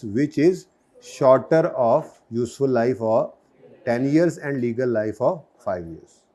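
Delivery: very slow, phrase by phrase with pauses; slow dictation. Shown as a demonstration. Teacher dictating an exam answer, very slow. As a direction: dictate it so students can write along. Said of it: "आराम आराम से मैं बोल रहा हूँ".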